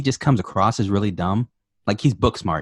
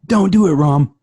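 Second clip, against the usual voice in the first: higher, louder cry of warning; mock urgent warning; 5 semitones higher, 6 dB louder than usual. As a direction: try to stop him in time. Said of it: "Right when she warns him".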